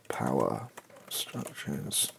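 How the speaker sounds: normal talking voice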